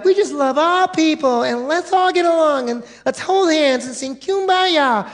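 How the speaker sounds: high sing-song parody of the easygoing churchgoer; mock naive voice. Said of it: "it's easy to be the church of love".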